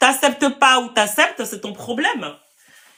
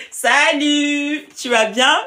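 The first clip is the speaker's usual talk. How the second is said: light up as you greet her; happy reunion call-out; excited greeting; 5 semitones higher, 4 dB louder, much slower than usual. Real, not acted. Greeting an old friend live.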